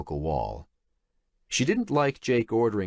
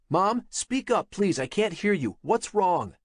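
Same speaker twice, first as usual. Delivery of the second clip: raised voice; 11 semitones higher than usual. Acted. Straining to hear on phone.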